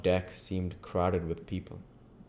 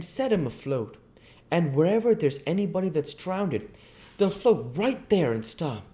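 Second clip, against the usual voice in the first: put-on high, loud boyish country voice; eager boy spelling out river lore; young Huck voice; 12 semitones higher, 5 dB louder than usual. A narrator's reading. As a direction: play a keen backwoods boy explaining. Huck voiced explaining drowning lore.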